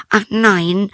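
very high princess voice; high-pitched fairy-tale princess; distressed princess. A narrator's reading. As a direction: deny it in a high girlish princess voice. Princess answers her father.

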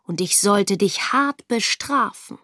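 plain reading voice

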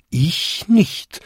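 high-pitched bell-like denial; chiming smaller bells. A narrator's reading. Next five bells answer in turn, chiming high. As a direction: chime it out high, like little bells. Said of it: "bampten und bimmten die nächsten fünf Glocken".

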